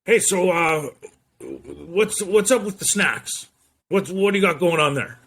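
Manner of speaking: high-pitched boss impression; Peter Parker's boss. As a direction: probe like a brash boss on the phone. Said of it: "he talked like that".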